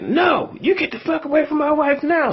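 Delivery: very high mock shout of the husband. Husband shouting again, retold.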